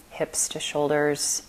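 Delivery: unhurried meditation voice, long silences between phrases; guided meditation. Acted. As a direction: lead them calmly through the body, letting phrases settle.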